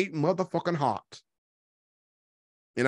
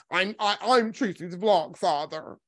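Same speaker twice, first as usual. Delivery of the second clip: slow, stammered mock self-introduction; Jay Wilson impression; slower than usual. Commentator mimicking a man who crashed the panel, slow mocking impression. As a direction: put on his self-important voice, drawn out. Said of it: "He came over there and went on that man's panel".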